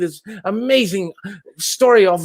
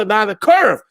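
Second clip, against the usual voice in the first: ordinary talk, nothing marked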